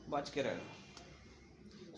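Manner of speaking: breathy, slow, hushed stay careful; whispered warning. Real, not acted. Lecturer to students about inherited COPD, a slow whispered warning. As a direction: lean in and breathe the warning slowly.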